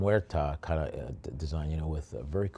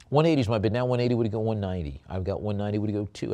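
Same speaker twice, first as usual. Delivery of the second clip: quick, louder bid call; auctioneer calling bids; 4 dB louder, much faster than usual. Acted. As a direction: keep the bids moving.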